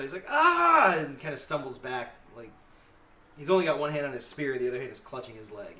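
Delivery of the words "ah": high, loud "ah" of pain; pained cry. Acted. Game master voices a guard stabbed in the thigh, pained cry. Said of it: "like, cries out".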